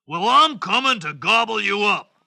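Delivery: big hammed-up troll voice; menacing troll. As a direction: threaten like a hungry storybook troll. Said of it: "said the troll".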